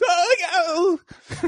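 high hammed-up "Oh, no"; sarcastic mock fear. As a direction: fake a terrified gasp, mocking. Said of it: "Like, sarcastically".

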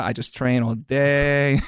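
imitation Portuguese-accented English, drawn out; slow, put-on Portuguese accent. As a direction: mimic a fighter's sudden Portuguese accent. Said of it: "has this Portuguese accent".

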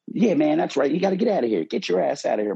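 high, strained voice of a small wonky manager; wonky little manager. Retold or imitated. Manager from Iowa tells the armed guy to leave: high, strained tough talk. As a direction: play him as a nervous little guy trying to act tough. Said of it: "a little dude from Iowa, a little wonky".

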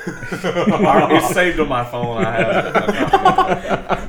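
laughing through the words; giggling, slow and high. Real, not acted. Laughing while claiming to have it.

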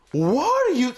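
incredulous what. Retold or imitated. Retelling his reaction to the movie, mock baffled. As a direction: exclaim at the absurd overkill. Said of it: "I'm like".

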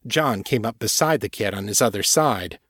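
plain reading voice

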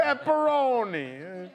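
slow, drawn-out New York Pepperoni; imitating a New York pizza guy; New York accent. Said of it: "It's a terrible ac-, it's my accent".

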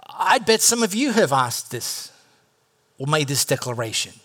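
ordinary talk

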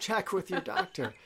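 high, laughing check with your doctor; laughing disclaimer. Retold or imitated. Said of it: "sound like the just typical".

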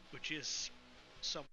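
ordinary talk

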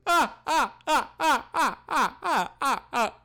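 high, loud fake ha-ha laughter; forced laugh. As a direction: laugh hard, pushing it out. Forced laugh at a bad joke.

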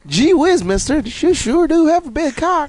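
put-on high, eager gee-whiz kid; squeaky innocent kid voice; wholesome 1950s kid. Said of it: "He goes".

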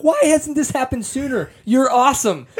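high-pitched delighted outburst; excited reaction. Retold or imitated. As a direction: gush as if just discovering someone great. Acts out own excited first reaction.

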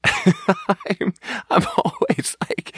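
real high giggle breaking into the words; laughing through words. Real, not acted. Waiter picturing himself at the table, laughing. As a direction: crack up as you start the story. Cracks up while setting up story.

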